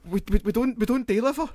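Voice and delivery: high-pitched, stammering confusion; hammed-up bewildered woman on the phone; flustered pizza worker. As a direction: squeak it out, thrown by the prank. Flustered pizza worker in retold prank.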